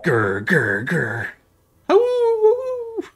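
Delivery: growls rising into a high, loud howl; playful wolf noises. Acted. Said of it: "He's going like".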